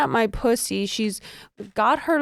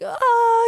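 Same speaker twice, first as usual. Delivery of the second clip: high-pitched imitated scream; mock scream; 13 semitones higher, 4 dB louder than usual. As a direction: act out a short, high cry of pleasure. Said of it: "I, I scream".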